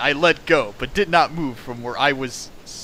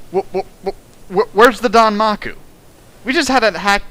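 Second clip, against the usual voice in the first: stammering, then louder and higher; exasperated outburst; 6 semitones higher, 3 dB louder than usual. Real, not acted. Reviewer sputtering at the fanfic, exasperated and rising. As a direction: sputter in disbelief and build to the question. Exasperated rant about missing danmaku.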